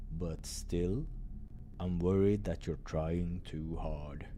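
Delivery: low slow caring concern; gentle bad news. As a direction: gently warn him to rest. Doctor gently warns Krille.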